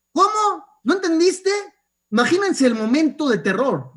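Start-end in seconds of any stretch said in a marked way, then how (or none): 0.1-1.5 agudo e incrédulo; que no lo pueda creer; sorpresa del maestro. Retold or imitated. Maestro sorprendido ante el alumno. (agudo e incrédulo)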